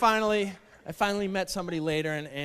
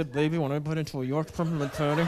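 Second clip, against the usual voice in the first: mock seductive clenched-teeth line; through clenched teeth; 2 semitones lower, 3 dB quieter than usual. Shown as a demonstration. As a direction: purr it through shut teeth. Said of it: "talk through clenched teeth".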